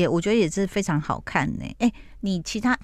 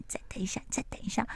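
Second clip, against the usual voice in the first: whisper; 13 dB quieter, faster than usual. Retold or imitated. Narrator hushing husband during call.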